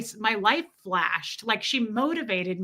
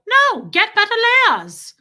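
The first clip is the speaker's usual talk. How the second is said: high, loud blunt no; curt Sophia; 11 semitones higher, 10 dB louder than usual. Retold or imitated. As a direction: snap the refusal shut, high and loud. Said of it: "she'd go".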